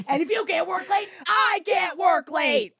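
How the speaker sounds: high-pitched shouted movie-line tirade; screaming. Retold or imitated. Ranter quoting Scrooged in a meltdown, shouting. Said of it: "As I'm screaming at the".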